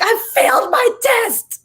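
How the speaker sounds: high, slow hammed-up wail; mock wail. Retelling crying to her dad on the phone, high mock wail. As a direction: wail it like a child who failed.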